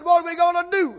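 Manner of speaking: high, loud panicked cry; retold frantic question; mock panic. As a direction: wail for help in hammed-up panic. Panicked plea in the boat.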